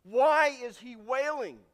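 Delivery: asked loud and drawn out, preaching at full force; preacher asks the congregation, loud and slow; loud question. Real, not acted. Preacher pressing the question on the congregation, loud and slow. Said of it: "I mean, he's wailing here".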